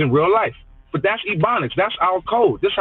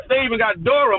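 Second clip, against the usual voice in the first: ordinary talk, nothing marked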